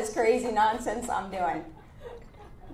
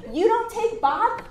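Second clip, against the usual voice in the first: high, slow, loud push; forceful threat; 11 semitones higher, 5 dB louder, slower than usual. Retold or imitated. Headhunter threatening the client on the phone, high and slow. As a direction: lay down the ultimatum.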